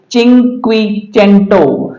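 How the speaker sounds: slow, careful चिंक्विचेंटो; चिंक्विचेंटो with a ch at the start; Italian pronunciation. Shown as a demonstration. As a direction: say चिंक्विचेंटो slowly as "cheen-kwee-CHEN-toh". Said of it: "इसका उच्चारण होता है".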